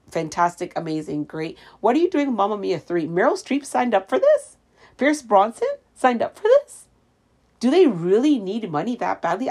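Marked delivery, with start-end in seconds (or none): 1.8-9.5 incredulous. Real, not acted. Outraged at Mamma Mia sequel.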